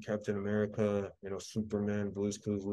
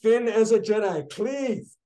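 high loud pleading; begging; 12 semitones higher, 9 dB louder, slower than usual. Real, not acted. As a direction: plead for it at the top of your voice. Begging for Finn as a Jedi.

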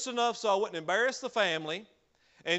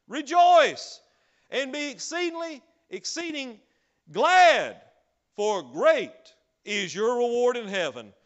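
much louder, high proclaiming cry on "Rejoice" and "glad"; preaching scripture; 5 semitones higher, 8 dB louder, slower than usual. Acted. Preacher proclaiming Matthew to the church, full-force preaching. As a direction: proclaim it like a shout of triumph.

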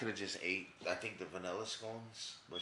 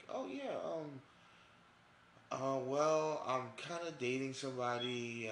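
put-on awkward girl voice; 6 semitones higher, slower than usual. Voicing girl letting him down.

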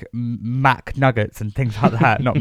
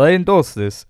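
higher-pitched mock Russian accent; 6 semitones higher, 3 dB louder than usual. Footballer Kaka endorsing a cola, Russian accent. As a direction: put on a comic Russian accent. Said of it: "Why did I do a Russian accent for Caca?".

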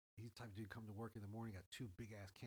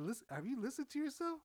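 higher and louder, incredulous; exasperated; 6 dB louder than usual. Retold or imitated. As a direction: call him out in disbelief. Exasperated retold retort to coworker.

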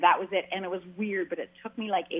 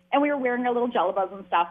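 ordinary talk

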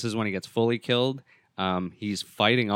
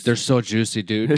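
leering dude voice; 6 dB louder than usual. Retold or imitated. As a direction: ham it up like a sleazy bro. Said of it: "Did he call them boobies?".

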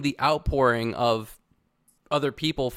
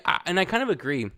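ordinary delivery

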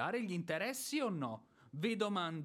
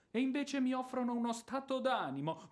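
mocking outrage; 8 semitones higher than usual. Landowner mocks widow's excuse, sarcastic. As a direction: throw her words back with scorn.

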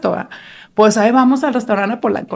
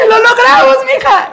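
higher, louder cheer; excited cheer; 10 semitones higher, 3 dB louder than usual. Retold or imitated. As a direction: cheer a mission done. Friends celebrating success.

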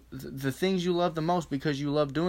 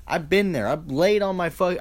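usual speaking voice

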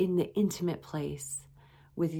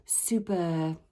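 ordinary delivery

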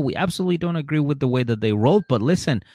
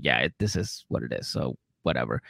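low, dismissive "whatever" voice, lightly done; mock dismissive husband; 8 dB quieter than usual. Husband brushing off his fiancée, mock low and dismissive. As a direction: shrug it off like a bored husband.

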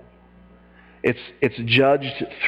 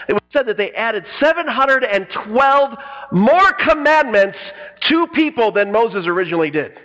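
normal talking voice

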